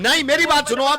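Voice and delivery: high, heated shout over the other man. Acted. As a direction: yell back in an argument. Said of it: "मेरे मत चीखो". (चीखकर, ऊँची आवाज़ में)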